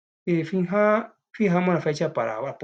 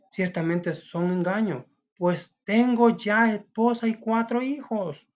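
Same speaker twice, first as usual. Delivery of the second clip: angry man; 7 semitones higher, slower than usual. Acted. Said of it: "con enojo".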